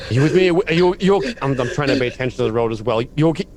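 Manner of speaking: high, loud frantic questions; panicked checking in. Acted. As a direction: rush out worried questions. Panicked driver checks bleeding passenger.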